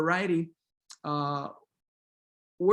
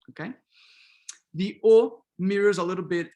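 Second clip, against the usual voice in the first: ordinary delivery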